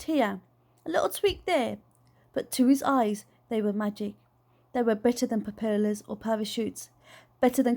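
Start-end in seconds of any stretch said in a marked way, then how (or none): none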